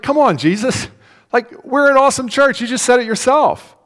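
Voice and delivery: high, loud indignant protest; mock church protest. Church protesting to Jesus, high and indignant. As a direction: play the offended church whining back at Jesus.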